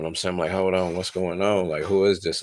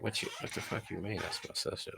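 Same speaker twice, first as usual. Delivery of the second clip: hushed disbelief; 2 semitones higher, 15 dB quieter than usual. Retold or imitated. Inner shocked reaction, hushed.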